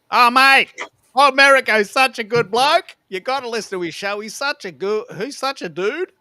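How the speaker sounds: high-pitched, gushing Aussie bloke; big Australian twang, opening on a shout; hammed-up Aussie. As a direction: rave like a loud Aussie mate. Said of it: "you really like this Aussie voice thing".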